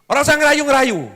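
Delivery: high, loud nagging; furious wife berating her husband for coming home late; angry scolding wife. Acted. Wife rejecting gift.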